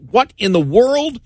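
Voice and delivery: high, baffled what in the world; incredulous. Retold or imitated. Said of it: "commented on that saying".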